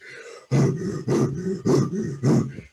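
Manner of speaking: loud hammed-up panting like a locomotive; exaggerated wheezing gasp; imitated heavy gasping. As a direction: mimic a climber sucking air. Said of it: "breathe like a locomotive".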